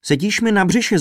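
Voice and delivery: high, loud moan of discomfort; wailed complaint. A narrator's reading. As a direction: whine it at the one squashing you. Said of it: "zakvílil trumpeťák".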